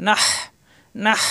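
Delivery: নাহ ending in a long hiss of h pressed mid-throat. Shown as a demonstration. Teacher drilling the articulation point, pressed throat H. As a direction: squeeze the throat on the final h of নাহ. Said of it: "হা কণ্ঠনালীর মাঝখানে চাপ দিয়ে".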